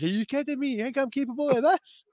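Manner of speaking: high, feigned outrage; mock innocence. He denies the stink bomb, high mock offence. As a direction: play the wrongly accused.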